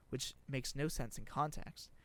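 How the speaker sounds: usual speaking voice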